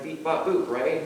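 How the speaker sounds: mechanical beep bop boop; robot beeps. Shown as a demonstration. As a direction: chirp it like a little robot. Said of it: "so what does a robot sound like?".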